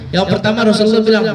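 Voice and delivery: ordinary talk, nothing marked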